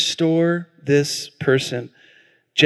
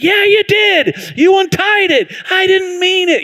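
accusing big sister; 13 semitones higher than usual. Retold or imitated. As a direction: taunt him back like a bossy sister.

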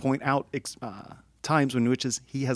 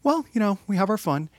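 lightly voiced Neil; 8 semitones higher than usual. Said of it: "He goes".

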